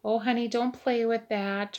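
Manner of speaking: high, loud doting granny voice; imitating the elderly resident, high and tender; sweet old lady voice. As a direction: coo like a tiny elderly lady calling a child.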